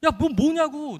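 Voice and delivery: loud, high-pitched exasperated outburst, reenacted; flustered scolding. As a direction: scold a crying friend in a panic. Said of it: "너무 당황해서 말았더니".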